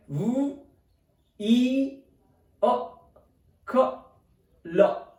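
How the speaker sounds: "v, e, a, k, l" as separate sounds with gaps. Shown as a demonstration. Teacher breaking down the British pronunciation, sounded out. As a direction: sound out "v, e, a, k, l" one by one. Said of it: "its pronunciation is written".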